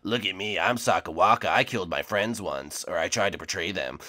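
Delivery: low, soft skeleton voice; lightly voiced Sakawaka. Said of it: "You can even do the voices?".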